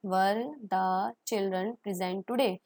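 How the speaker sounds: very slow repeat, rising question; slow model question. Shown as a demonstration. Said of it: "model question". (धीरे-धीरे सवाल दोहराना)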